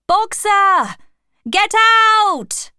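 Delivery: high, loud, stretched-out yell; frantic shouting. A narrator's reading. Animals all shout to Boxer to escape, frantic. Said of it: "The animals were all shouting now".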